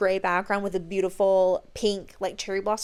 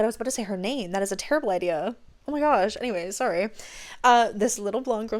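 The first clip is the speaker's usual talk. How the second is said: usual speaking voice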